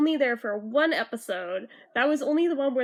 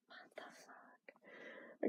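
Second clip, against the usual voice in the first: slow, stunned whisper; 23 dB quieter, much slower than usual. Real, not acted. Fan baffled by how the characters talk, slow whisper. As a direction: breathe it out in disbelief.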